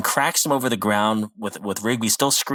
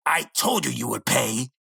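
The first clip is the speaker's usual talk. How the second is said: menacing wizard. Retold or imitated. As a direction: threaten like a creepy wizard. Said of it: "He goes".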